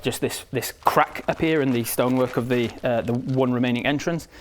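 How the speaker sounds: usual speaking voice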